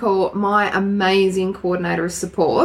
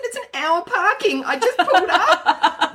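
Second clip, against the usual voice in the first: indignant retelling; 12 semitones higher than usual. Retold or imitated. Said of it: "I said".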